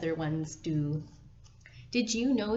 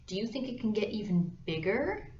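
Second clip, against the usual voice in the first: ordinary talk, nothing marked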